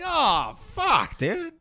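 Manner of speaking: very high, loud squealed outburst; stunned. Retold or imitated. Boy reacts to the Magic Grape reveal, shrieking surprise. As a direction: yelp it in shocked delight. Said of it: "I was like".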